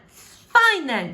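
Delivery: defiant cry. Acted. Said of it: "she cried".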